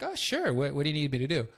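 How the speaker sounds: high-pitched mock eagerness; suddenly obliging. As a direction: jump up high, acting suddenly keen to help. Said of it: "my attitude changed".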